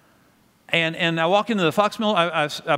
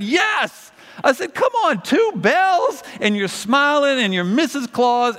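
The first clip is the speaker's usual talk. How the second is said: enthusiastic praise; 7 semitones higher, slower than usual. Retold or imitated. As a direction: cheer her on with big emphasis. Pastor enthusing to bell ringer.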